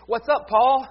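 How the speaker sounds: calling out a hearty hello; loud casual greeting. Retold or imitated. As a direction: shout a cheery what's up.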